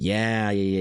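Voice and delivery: ordinary talk, nothing marked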